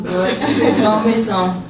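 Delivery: loud laugh breaking through the words. Real, not acted. Player laughing to reporter about missing shots, loud laughing.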